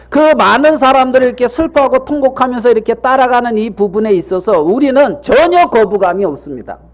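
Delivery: normal talking voice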